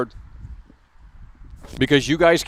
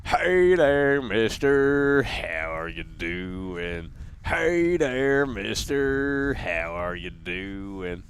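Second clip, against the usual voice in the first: a creepy greeting that sinks much lower and softer at the end; character voice; 6 semitones lower, 6 dB quieter, much slower than usual. Pretend. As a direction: greet him sweetly, then drop to a deep slow murmur.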